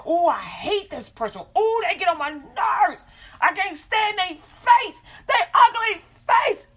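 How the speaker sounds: rising into a high, loud mock scream of disgust; building from a whine to a high, loud mock shriek; frustrated hate rant. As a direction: act out hating someone till it bursts out. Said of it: "you get all frustrated".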